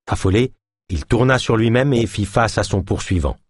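usual speaking voice

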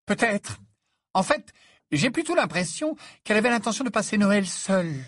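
high voice of a put-out mother; annoyed mother figure. Acted. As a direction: make the point pointedly, pitched high. Narrator voices Mrs Weasley. (voix de mère aiguë, agacée)

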